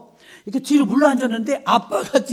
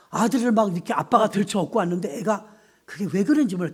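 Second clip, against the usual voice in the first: ordinary talk, nothing marked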